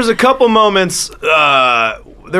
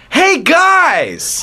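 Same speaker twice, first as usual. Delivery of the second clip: high-pitched mock greeting; imitated casual greeting; 10 semitones higher than usual. Host imitates the Avatar greeting the monastery, mock chummy call. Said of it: "the first thing he says is".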